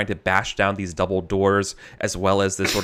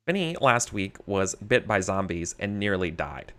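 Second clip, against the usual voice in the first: story narration; 6 dB quieter than usual. A narrator's reading. GM narrates the flashback scene.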